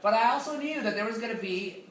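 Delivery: ordinary delivery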